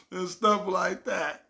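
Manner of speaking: normal talking voice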